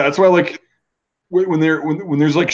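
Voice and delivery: usual speaking voice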